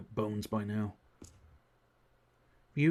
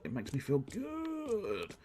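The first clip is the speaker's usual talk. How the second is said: contented sing-song; 7 semitones higher, 3 dB quieter, much slower than usual. Real, not acted. Blissful sing-song about saving.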